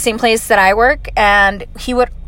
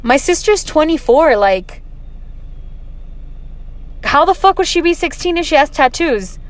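higher-pitched mock indignation; retold incredulous protest, pitched up; indignant brother; 6 semitones higher than usual. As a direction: imitate an affronted brother setting someone straight. Brother correcting the nurse, retold.

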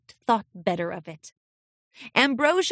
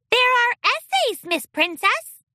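high squeaky little filly; 14 semitones higher, 4 dB louder than usual. A narrator's reading.

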